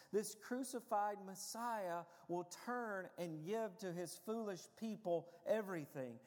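normal talking voice

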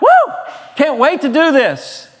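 a sudden high whoop of joy; hammed-up excited whoop; mock cheer. Fired up about hobbies.

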